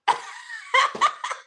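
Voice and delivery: real tickled laughter; laughing. Real, not acted. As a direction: burst out laughing, high and long. Amused by comment about their dad.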